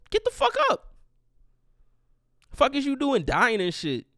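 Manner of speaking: loud, high-pitched taunting rant; mock outrage. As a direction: berate the man in the casket, voice leaping high. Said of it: "walk into the casket like".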